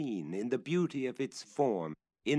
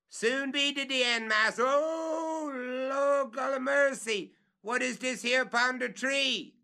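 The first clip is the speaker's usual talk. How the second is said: much higher, louder, crying out to the Lord; frightened calling; 6 dB louder than usual. Acted. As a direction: cry out at what he finds.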